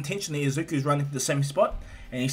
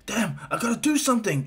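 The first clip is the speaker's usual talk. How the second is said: high, urgent resolve; desperate hero; 7 semitones higher than usual. Acted. Hero watching helplessly, high and urgent. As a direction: say it urgently, pitched up. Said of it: "he looks over and he's like".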